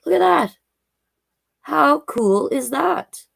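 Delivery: high and bright with wonder, the second line slow; delighted show-and-tell gasp; excited. Real, not acted. Showing kids the whale tail.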